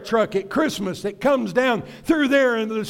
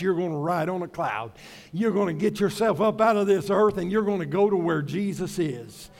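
ordinary delivery

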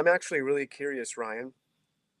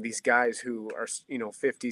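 ordinary talk, nothing marked

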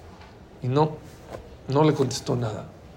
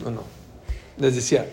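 ordinary talk

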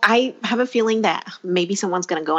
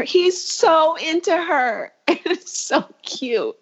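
gushing; 8 semitones higher, slower than usual. Real, not acted. Gushing about the couple.

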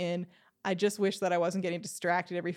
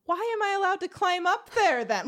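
baffled question; 10 semitones higher, 4 dB louder than usual. Retold or imitated. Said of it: "But I was like".

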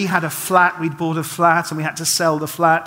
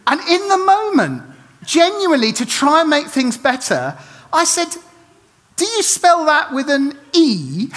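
flustered storytelling; 8 semitones higher than usual. Retold or imitated. Awkward story climax.

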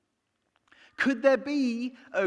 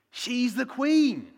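slow, higher and louder on Queen; emphatic; 7 semitones higher, 6 dB louder, much slower than usual. Real, not acted. Preacher explaining why staff serve the Queen, emphatic and slow. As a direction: lean into Queen, slowly.

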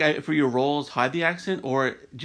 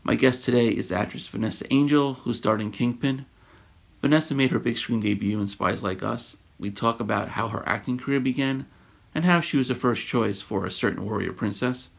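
radio show intro; 4 dB louder than usual. Acted. Podcast host reads intro.

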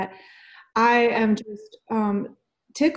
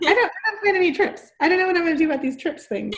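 a light laughing mimic of her friend, higher and fast; playful imitation; 8 semitones higher, much faster than usual. Host lightly mimicking her friend's trip worries. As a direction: tease her by echoing her worry, lightly. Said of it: "since you're like".

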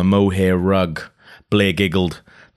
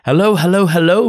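much higher and louder, rising hellos; crowing hostess; 12 semitones higher, 8 dB louder than usual. Acted. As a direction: crow a greeting to the guests. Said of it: "Blair's mother crowed".

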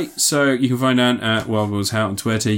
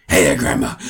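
much lower menacing villain voice; Kurgan impression; 10 semitones lower, 3 dB louder than usual. Quoting the Kurgan from Highlander, low and menacing. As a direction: growl it low like a leering brute. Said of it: "It does have Clancy Brown as the Kurgan".

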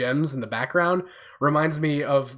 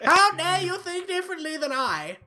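mock outrage in a much higher voice; shaking with anger. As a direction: play the offended fan, high and laughing. Mock scolding over a personal opinion.

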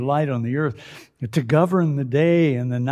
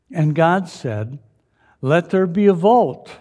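loud, high, proclaiming scripture reading; booming biblical narration; 6 semitones higher, 6 dB louder than usual. Acted. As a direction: boom it out like a preacher.

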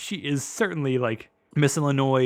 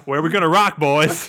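put-on drunk party guy; 3 semitones higher, 6 dB louder than usual. Voicing drunk Luke.

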